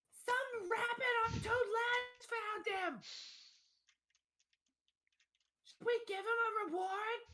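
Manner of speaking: high-pitched hollering husband; husband yelling back. Acted. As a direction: shout across the house in a put-on voice. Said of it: "The husband responds".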